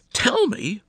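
high, loud indignant shriek; hammed-up shrill disbelief. A narrator's reading. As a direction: screech it back in outrage. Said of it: "Missus Galante shrieked".

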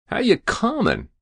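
high-pitched, offhand rough greeting; brash lout. A narrator's reading. Hero greeting the President, brashly. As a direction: toss it out in a high, cheeky voice.

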